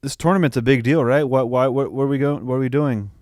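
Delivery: normal talking voice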